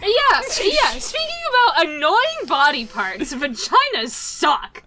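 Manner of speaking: loud, high-pitched venting; exasperated rant. Real, not acted. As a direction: launch in loudly, fed up. Launches rant about periods.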